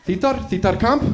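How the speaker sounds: put-on THEATER CAMP said foreign-accented, like "teetar kahmp"; retry it in a thick foreign accent. Explaining theater camp to relatives, put-on foreign accent.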